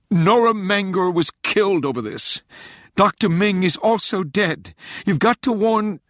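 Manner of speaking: high, loud, desperate woman; a frantic agent pleading urgently; frantic young woman. A narrator's reading. Rachel desperate.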